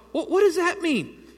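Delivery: high, slow, stunned disbelief. Retold or imitated. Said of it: "And I thought".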